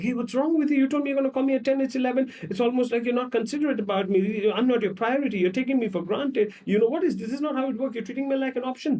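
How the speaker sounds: high, fast scolding rant; mock angry girlfriend. Girlfriend scolding boyfriend for calling late, high and fast. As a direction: lay into him for calling late.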